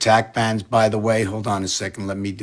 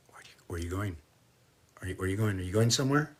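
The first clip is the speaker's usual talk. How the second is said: hushed whisper to the cat; 10 dB quieter, much faster than usual. Real, not acted. Owner softly asking her sneaking cat, hushed whisper. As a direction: whisper so the cat isn't spooked.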